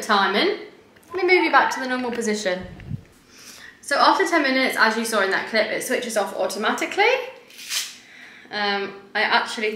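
speaking from behind mask; wearing a face mask. Real, not acted. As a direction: mask over face, mouth exposed.

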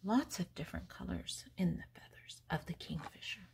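whisper. Acted. Whispered aside about kingfisher.

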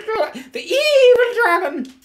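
very high, loud, drawn-out flourish; hammed-up storyteller. Adult offers Sam a tale of the mayor, hammed-up shriek. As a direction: shriek it slowly for dramatic effect. Hams up a tale's villain.

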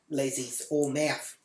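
normal talking voice

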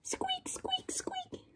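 mouse squeaking. Acted. Mouse answering the cat, tiny whispered squeaks.

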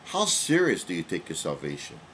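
normal talking voice

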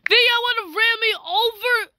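squealing in panic at the traffic; alarmed yelp. Real, not acted. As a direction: yelp as a car hits you. Vehicles running her over.

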